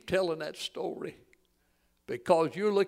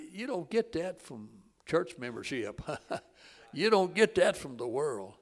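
ordinary delivery

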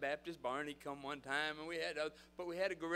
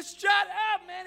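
loud, high-pitched retold shout; exasperated yell; 16 dB louder than usual. Leader to gorilla man, exasperated shouting. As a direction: yell to stop the rampage.

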